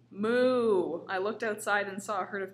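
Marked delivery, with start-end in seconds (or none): 0.1-1.0 cow moo. A narrator's reading. Cows mooing in the story.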